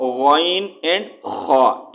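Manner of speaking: غین and خا drawn out very slowly from the upper throat; throat letters. Shown as a demonstration. Teacher demonstrates upper throat letters, very slowly. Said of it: "حلق کے اوپر والے حصے سے".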